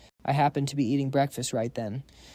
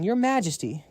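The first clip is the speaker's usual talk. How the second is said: higher, excited call of Your Majesty; excited shout; 6 semitones higher than usual. A narrator's reading. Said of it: "he jumped up shouting".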